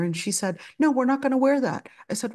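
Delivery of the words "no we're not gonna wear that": bratty refusal. Retold or imitated. Girl refuses the maids' clothes.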